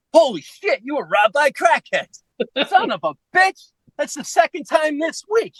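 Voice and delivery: high-pitched, incredulous exclamation; shocked outburst. Retold or imitated. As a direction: imitate a buddy's astonished reaction. Quotes Pat's shocked outburst.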